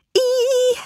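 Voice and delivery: very high delighted squeak; excited squeal. A narrator's reading. Nikki squealing with joy about her party, high squeak. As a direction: squeal with pure glee.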